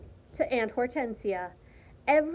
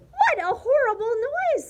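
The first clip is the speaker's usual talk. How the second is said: high, loud appalled cry; horrified disgust; 12 semitones higher, 8 dB louder than usual. A narrator's reading. Martina rejecting the pig's noise, high and appalled. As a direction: recoil from the noise in dismay.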